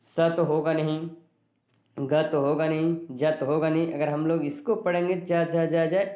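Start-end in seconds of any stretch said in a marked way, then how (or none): none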